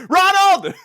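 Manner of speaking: high, loud comic cry; mock shout. Co-host tops the dad's-name riff, mock shout. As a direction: bellow the name as a joke exclamation.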